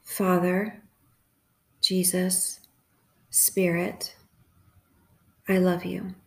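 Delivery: unhurried prayer in short, separated phrases; calm, measured meditative praying; guided prayer meditation. Acted. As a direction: leave long silences for listeners to repeat each phrase.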